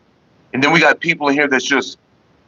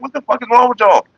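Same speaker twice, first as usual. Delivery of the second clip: indignant retort; 9 semitones higher than usual. Retold or imitated. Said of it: "I'm like".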